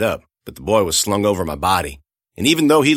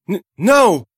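anguished cry; 11 semitones higher, 6 dB louder than usual. Acted. Girl sees boy lying dead.

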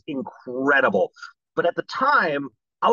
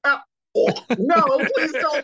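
mock dismay; 13 semitones higher, 5 dB louder than usual. Actor begging producers to drop the accent, mock dismay. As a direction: plead in exaggerated horror, pitch way up.

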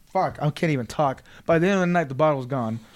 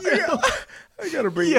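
laughing. Real, not acted. Still laughing hard.

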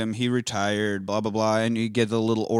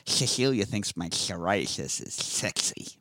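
put-on quieter, slow nerdy kid voice; slowly, lisping like a nerd; Family Guy nerd; 5 semitones lower, 8 dB quieter, slower than usual. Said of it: "Family Guy gag where he'd take his retainer out".

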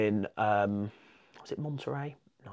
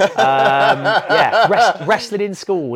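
high and loud through real laughter; bursting into laughter mid-sentence; laughing; 8 semitones higher, 14 dB louder, much slower than usual. Real, not acted. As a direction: laugh the words out, slowly. Laughing after a story.

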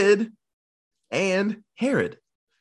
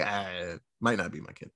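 callous shrug; 10 semitones lower, 9 dB quieter than usual. Retold or imitated. Callous father shrugs off son.

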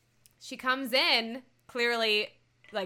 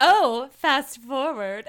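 mock flirty line; 7 dB louder, much slower than usual. Retelling a flirty wink reply.

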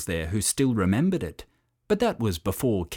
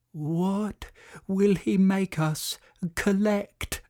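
whispering turtles; 10 semitones higher, slower than usual. A narrator's reading. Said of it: "whispered the turtles".

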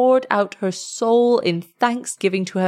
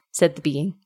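ordinary delivery

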